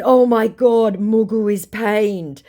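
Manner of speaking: high, drawn-out mock shock; mock taunting. Baiter gloating at the pained scammer, taunting. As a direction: tease with exaggerated amazement.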